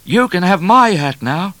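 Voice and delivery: high, loud call-back; cheeky offer. A narrator's reading. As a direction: offer it loudly to your pursuer. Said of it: "offered Midnight".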